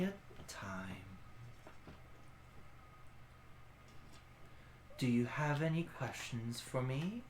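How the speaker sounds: quietly grave, almost whispered; hushed ancient guardian; ancient creature voice. Acted.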